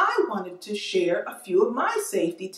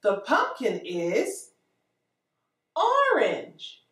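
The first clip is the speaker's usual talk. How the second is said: put-on reading to a toddler; much slower than usual. Teacher reading to small children.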